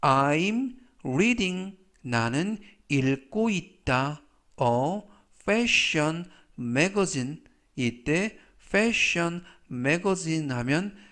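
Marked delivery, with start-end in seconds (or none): 0.0-1.8 I'm reading said very slowly and clearly; slow example reading. Shown as a demonstration. Teacher reading the example sentence to students, very slow. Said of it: "활용 문장 보시겠습니다". 4.6-7.4 fashion magazine said very slowly and clearly; slow example reading. Shown as a demonstration. Teacher reading the example sentence to students, very slow. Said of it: "활용 문장 보시겠습니다".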